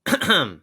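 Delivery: sharp, loud, pointed throat clearing. Acted. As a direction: clear your throat to get attention. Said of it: "Deadpool clears his throat".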